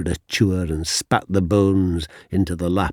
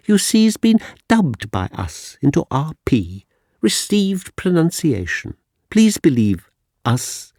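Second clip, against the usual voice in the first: plummy schoolmaster lecturing, much higher and louder; posh teacher; 10 semitones higher, 7 dB louder than usual. Retold or imitated. Schoolmaster lecturing a working-class pupil, plummy and condescending. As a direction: talk down to him in received pronunciation. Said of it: "He was nicely spoken".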